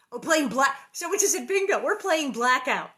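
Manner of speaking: loud, high burst of realization; excited announcement. Real, not acted. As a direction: announce the game to everyone. Sudden announcement of bingo rule.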